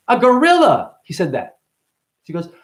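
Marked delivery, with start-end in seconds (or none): 0.1-1.0 put-on little kid voice. Kid's answer voiced.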